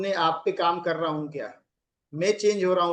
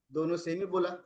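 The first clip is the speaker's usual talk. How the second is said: ordinary talk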